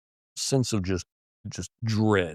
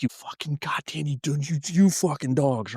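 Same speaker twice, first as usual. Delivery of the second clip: higher, exasperated yelling at dogs; man swearing at his frightened dogs; angry yell; 6 semitones higher than usual. Retold or imitated. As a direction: curse them out, pitched up. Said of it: "I was yelling at 'em".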